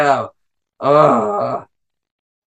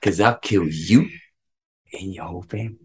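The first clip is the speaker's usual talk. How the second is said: low menacing threat; 4 semitones lower, 8 dB quieter than usual. Retold or imitated. Quoting Vulture in the car.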